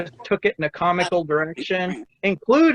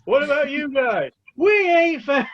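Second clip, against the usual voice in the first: higher-pitched mock film shouting; calling out; 7 semitones higher than usual. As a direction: call across the desert to the searchers, hammed up. Acted film scene, calling to desert searchers.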